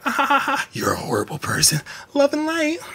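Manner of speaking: mock a fake love-and-light person; fake sweet mockery. Mocking fake spiritual people, sugary then cold. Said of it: "When people are like".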